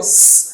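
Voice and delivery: স as a long "sss"; drawn-out s hiss. Shown as a demonstration. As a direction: hold স as a pure hissing s. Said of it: "এই সাউন্ডটা আসবে".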